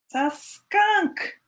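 mock alarm for the kids; surprised reveal. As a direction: act startled to see the skunk.

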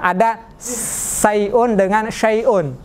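"sayun" with a plain s, then "syai'un" with sh, both drawn out; very slow careful contrast of "sayun" and "syai'un"; slow sad versus shin. Shown as a demonstration. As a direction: set "sayun" against "syai'un", slowly and clearly. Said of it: "Kalau syai'un pakai shin".

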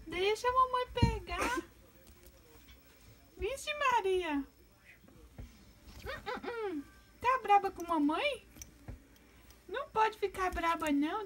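high, slow cooing pet voice; calm the angry bird like a baby; high slow baby talk to an angry pet; baby talk soothing. Acted.

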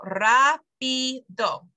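"rápido" very slowly in three parts, RÁ stressed; slow teaching "rápido", first syllable strongest. Shown as a demonstration. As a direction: break "rápido" into RÁ, pi, do. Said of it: "there's three syllables there".

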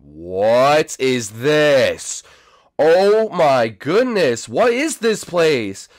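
very slow, stretched-out disbelief; slow, swooping astonished exclamation; drawn-out astonishment. Real, not acted. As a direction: stretch every word in amazement. Astonished at the strange room.

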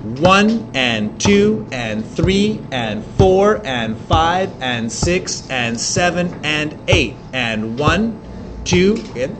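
slow beat count, one and two, stress on each number; counting beats slowly. Shown as a demonstration. Teacher counting the trochee beat aloud with the class. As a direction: tap out the rhythm aloud. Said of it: "Ready? Here we go".

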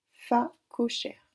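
"Fa cochère" lent, fa... co... chère; détacher les syllabes de "Fa cochère"; découpé en syllabes. Shown as a demonstration. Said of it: "Donc on écoute bien". («Fa cochère» dit lentement, syllabe par syllabe)

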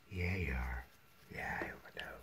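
soft whisper to the cutie; whispered cooing. Real, not acted. Owner cooing to a cute puppy, hushed whisper.

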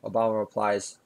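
ordinary talk

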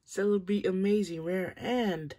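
high, loud, thrilled shouting; real excitement over a rare card; freaking out. Real, not acted. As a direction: shout with joy at the rare pull. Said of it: "I'm freaking out".

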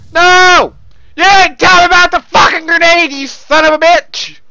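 outraged shout at a teammate; angry yelling. Real, not acted. Gamer blaming someone over a grenade, angry yelling. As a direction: yell it in real gamer rage. Enraged at grenade death in game.

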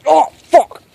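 sudden very high, loud mock cry of pain; fake pain yelp. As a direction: yelp as if pricked, then drop it. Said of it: "Just kidding".

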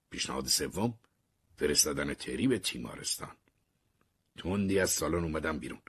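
ordinary delivery

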